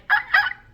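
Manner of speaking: very high, loud yelping "ah, ah"; imitated yelp call. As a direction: yelp it out like calling a dog. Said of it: "make this noise".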